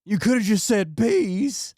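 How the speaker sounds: high, drawn-out mock whine; complaining wife. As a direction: imitate her protest, slow and high-pitched. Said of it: "And she's like".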